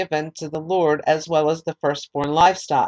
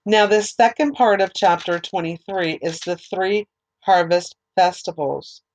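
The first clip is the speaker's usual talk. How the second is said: ordinary delivery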